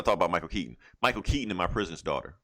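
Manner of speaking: ordinary delivery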